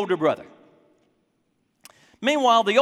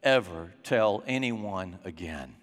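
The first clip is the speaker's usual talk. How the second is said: a stern dad coolly shutting out his returning son; cold rejecting father; 7 semitones lower, 6 dB quieter than usual. Pretend. Older brother imagines dad rejecting the prodigal, icy and quiet. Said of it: "he wanted Dad to say".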